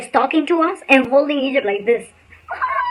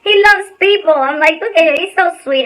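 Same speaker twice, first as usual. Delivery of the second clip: higher, sweet coo over a pet; cooing baby talk; 5 semitones higher, 3 dB louder than usual. Retold or imitated. Showing the scared actor her snake, cooing.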